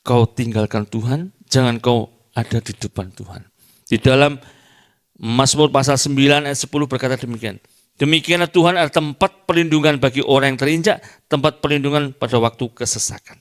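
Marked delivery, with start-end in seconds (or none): 3.9-13.4 loud, high, proclaiming the psalm; forceful preaching. Acted. Pastor proclaims Psalm nine to the congregation, loud and high. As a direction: declaim the scripture to the hall.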